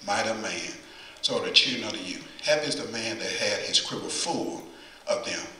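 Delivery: measured, solemn reading of the verses; reading scripture from the pulpit. Acted. Reads scripture aloud.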